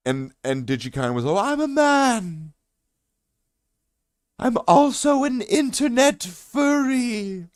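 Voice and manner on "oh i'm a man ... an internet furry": high, slow, drawn-out put-on greeting; odd stranger voice. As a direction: stretch every word, high and strange. Stranger answering.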